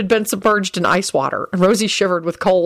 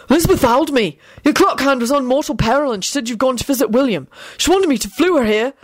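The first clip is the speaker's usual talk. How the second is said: high, flustered report; 6 semitones higher than usual. A narrator's reading. Neville brings urgent news to Lupin, flustered. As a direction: blurt the news high and anxious. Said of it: "he stammered out".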